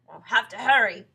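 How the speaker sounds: loud, firm warning; urging husband. Acted. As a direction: press her to act. Husband urges frightened wife.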